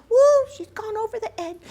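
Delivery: high-pitched comic whoop; mock alarm. Mock alarm at daughter's hysterics.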